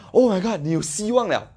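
mock excited phone call; excited exclaim. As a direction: gasp it out with renewed hope. Imagined call bringing new hope.

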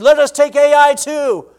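high, loud and slow, eager to win; excited. Retold or imitated. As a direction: crow it like soldiers sure of victory. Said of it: "they were so excited".

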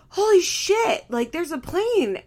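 high, loud retold shock; mock alarm. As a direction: exclaim it as an imitation of someone startled. Retelling alarm at low plane.

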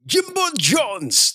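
slow, high announcer drawl on the name; drawn-out name. Pretend. Host hyping a commenter's name, slow and high. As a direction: stretch the name out like an emcee.

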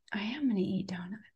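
hushed whisper agreeing; a quiet whispered yes to donuts. Retold or imitated. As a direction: whisper it like a secret promise. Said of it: "And I was like".

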